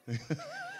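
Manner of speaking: sudden high squeal of a laugh; high squeaky laugh. Real, not acted. Preacher laughs at being called a goat, high squeal. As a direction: burst into a high squeaky giggle. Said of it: "I sound like a goat".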